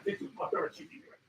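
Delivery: usual speaking voice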